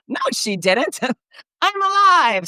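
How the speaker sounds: rising high, laughing protest; surprised retort. Retold or imitated. Daughter corrects the announcer, rising high and laughing. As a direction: push back, climbing into a squeal. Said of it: "She was like".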